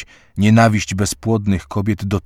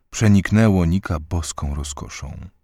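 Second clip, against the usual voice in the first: ordinary delivery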